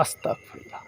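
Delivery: whispered prayer, barely a breath; frightened whisper. Real, not acted. Praying under his breath in the dark jungle, whispered. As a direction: breathe the prayer out in fear, voiceless.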